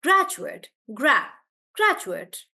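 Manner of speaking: "graduate" with GRA punched, also alone; stress on GRA. Shown as a demonstration. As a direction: hit GRA, then a weak "jut" in "graduate". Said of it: "I'm stressing on the first part".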